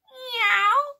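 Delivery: loud, high rising meow; cat meow. Acted. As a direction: pounce on it like a cat. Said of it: "when the cat went".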